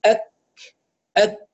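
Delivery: أك stopped hard, ending in a puff; firm then breathed. Shown as a demonstration. Teacher illustrates the letter's definition, firm then breathed. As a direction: shut on k in أك, then let air out. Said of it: "يبدأ بشدة وينتهي بهمس".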